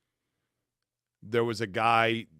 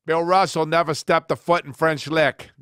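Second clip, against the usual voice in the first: mock boast; 6 semitones higher, 9 dB louder, slower than usual. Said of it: "And then he pauses".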